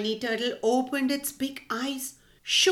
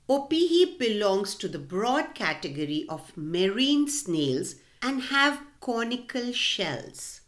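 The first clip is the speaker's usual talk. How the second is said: usual speaking voice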